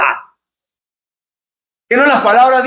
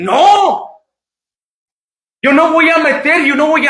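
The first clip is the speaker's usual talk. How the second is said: firm refusal; 4 semitones higher than usual. Acted. The wise virgin refusing the boy, a firm high no. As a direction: slam the door on temptation.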